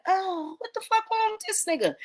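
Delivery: mock-shocked exclamation at a video; shocked disbelief. Streamer retelling first reaction to clip, high shocked disbelief.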